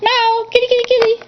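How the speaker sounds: very high, rapid-fire kitty call; calling the cat. Real, not acted.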